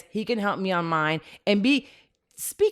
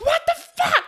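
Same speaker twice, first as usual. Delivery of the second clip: shocked outburst; 4 dB louder than usual. Retold or imitated. Her shocked reaction quote.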